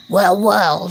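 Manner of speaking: smooth, sly politeness; polite wolf. A narrator's reading. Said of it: "said the wolf politely".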